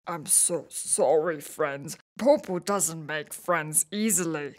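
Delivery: soft, sincere apology; earnest apology. Acted. Big Bro apologises to upset friends, softly earnest. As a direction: say sorry gently and mean it. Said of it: "Big Bro's earnest apology".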